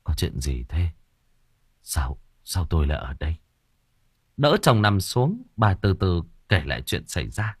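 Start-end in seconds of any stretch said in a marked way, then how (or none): 0.0-3.4 lower, softer, faint sickbed voice; weak rasp. Acted. Husband just waking in hospital asks wife, faint and weak. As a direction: barely get the words out, half awake. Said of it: "ông thều thào".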